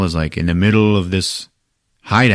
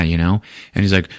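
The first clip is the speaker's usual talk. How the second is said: ordinary delivery, nothing marked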